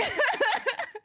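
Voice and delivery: high squeaky laugh. Real, not acted. Laughs at own crying.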